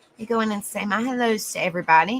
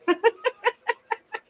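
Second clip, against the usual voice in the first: high giggle; 12 semitones higher than usual. Real, not acted. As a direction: laugh way up high. Laughing at being Southern.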